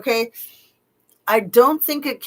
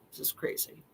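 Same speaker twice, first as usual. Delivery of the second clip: breathy whisper under the breath; hushed disbelieving whisper; whispered aside; 16 dB quieter, faster than usual. Real, not acted. Aside after disbelieving rant.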